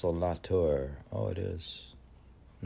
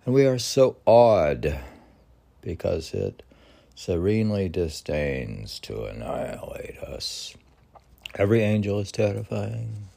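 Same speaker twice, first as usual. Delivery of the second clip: poem recitation; 3 semitones higher, 6 dB louder than usual. Acted. Podcast host reading Rilke's elegy aloud, solemn recitation. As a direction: let each line land like a solemn revelation.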